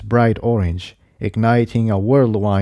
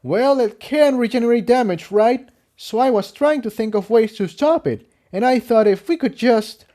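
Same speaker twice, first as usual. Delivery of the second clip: much higher voiced character, thinking aloud; stallion explaining; 12 semitones higher than usual. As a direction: explain the plan, cut off. Jigsaw explaining his plan.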